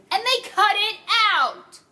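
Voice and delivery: loud, high indignant cry; outraged shout. Real, not acted. Fan outraged the movie dropped a scene, shouting. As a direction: yell it like the scene was stolen.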